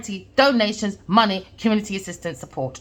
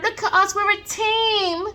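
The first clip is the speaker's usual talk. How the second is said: high, smug mock voice of a greedy crew; a hammed-up boastful gang voice; mocking quote of the schemers; 7 semitones higher than usual. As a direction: mimic them showing off, then a laugh. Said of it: "They're all going around".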